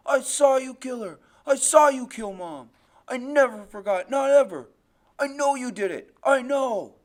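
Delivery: loud, high, anguished accusation; accusing scream. Acted. Boy accuses his father of killing Mom, desperate shouting. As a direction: hurl the accusation at full voice. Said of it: "all I can do is keep screaming".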